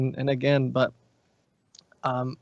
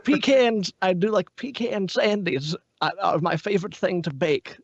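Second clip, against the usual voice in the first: higher Russian-accented grandma; 5 semitones higher than usual. Shown as a demonstration. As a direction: put on a Russian granny accent. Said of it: "I'm doing the Russian voice".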